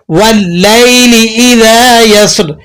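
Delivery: slow melodic tajweed recitation; Quran recitation. Shown as a demonstration. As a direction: recite the verse with measured melody. Recites verse.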